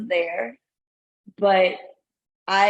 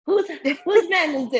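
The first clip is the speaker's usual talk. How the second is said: mock call out; 7 semitones higher than usual. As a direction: call for his owner, teasing. Mock calling for the owner.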